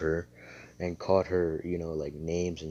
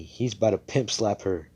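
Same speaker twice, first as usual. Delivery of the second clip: plain reading voice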